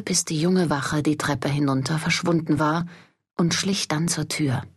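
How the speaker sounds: ordinary delivery, nothing marked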